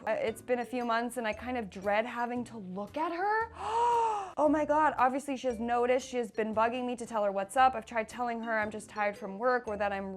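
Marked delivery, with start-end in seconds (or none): 3.5-4.3 high, sudden gasp; shocked gasp. Real, not acted. Reader reacting to the husband's dread, shocked. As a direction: gasp as if scandalized.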